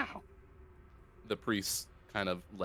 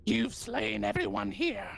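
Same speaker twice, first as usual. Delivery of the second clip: high goblin voice; squeaky goblin priest; 12 semitones higher than usual. Acted. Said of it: "gonna use a different voice for this".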